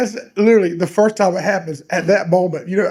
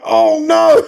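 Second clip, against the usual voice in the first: higher, louder drawn-out alarm; mock dread; 6 semitones higher, 5 dB louder than usual. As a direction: play sudden helpless horror. Acts own dread mid yank.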